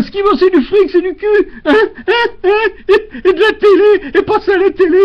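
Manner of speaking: high, loud, laughing through the rant; high ranting laughter tipping into crying. Acted. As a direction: rant till the laugh cracks into a sob. Rant ends in laughing tears.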